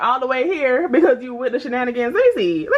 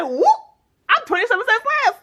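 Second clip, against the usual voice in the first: high, fast excited reaction; 11 semitones higher, faster than usual. Retold or imitated. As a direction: gush about a bargain, fast and high.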